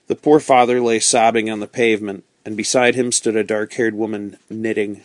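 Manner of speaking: usual speaking voice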